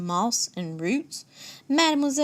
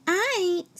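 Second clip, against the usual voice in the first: higher, lightly snickered refusal; snickering; 9 semitones higher than usual. A narrator's reading. Madam Skunk refusing to help Monsieur Gator, snickering. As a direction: toss it off with a little snicker. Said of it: "snickers Madam Skunk".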